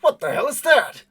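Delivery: high, loud startled yell from a stranger; a man caught off guard, yelling; startled shout. Acted.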